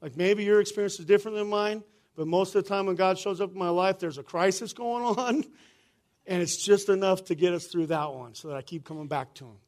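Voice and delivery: ordinary delivery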